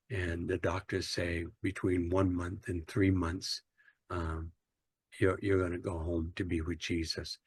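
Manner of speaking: gently telling a dying friend through tears; retold crying. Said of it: "I'm crying uncontrollably".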